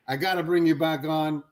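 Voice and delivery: normal talking voice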